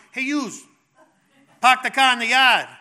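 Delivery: put-on loud, high Rhode Island accent. Preacher mimicking visiting missionaries' speech, Rhode Island accent. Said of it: "start speaking with an Australian accent. If they were from Rhode Island".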